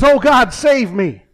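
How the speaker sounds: loud, pleading cry for help; desperate cry to God. Acted. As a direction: yell out to God in panic. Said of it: "he calls out to God".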